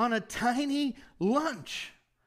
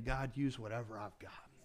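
much softer, lower, hushed plea; quiet prayer; 12 semitones lower, 12 dB quieter than usual. Acted. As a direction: pray it low and intimate, almost to yourself. Preacher voicing a prayer.

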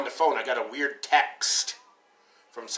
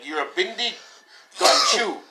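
higher-pitched mimicked taunt; retold insult to caller; 8 semitones higher than usual. Friend taunting a scam caller, mimicked taunt. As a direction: toss the foreign insult down the phone. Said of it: "and he's like".

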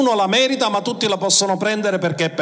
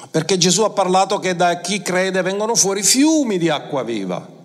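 ordinary delivery

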